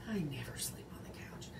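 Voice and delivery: whispered confession; whispering. Real, not acted. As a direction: lean in and whisper it. Confessional aside to viewers.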